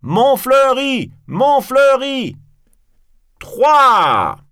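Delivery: high, booming drawn-out call; bellowed warning count; loud shout. Acted. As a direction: shout it across a theatre, stretching each word. Cyrano shouts a countdown at actor.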